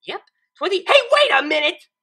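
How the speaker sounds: loud, high jolt of alarm; sudden realization. A narrator's reading. Twilight realizing Pinkie's trick at the board. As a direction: catch yourself mid-word and cry out.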